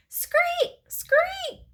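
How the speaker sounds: very high piercing screek; two sharp high frog shrieks; screaming frog screech. A narrator's reading. Frog bashing the bag, screaming.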